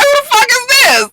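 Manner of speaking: high squeal through laughter; laughing disbelief. Retold or imitated. Viewer reacting to an unknown new girl, laughing disbelief. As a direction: crack up while asking, voice shooting way up.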